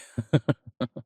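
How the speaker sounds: light, rippling chuckle. Acted. Immortal amused by recalling America as a British colony, chuckling. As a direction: chuckle to yourself in short pulses.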